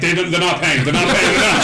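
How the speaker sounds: high, laughing flustered denial; giggling staffer correcting the greeter; laughing protest. Acted. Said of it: "He's like".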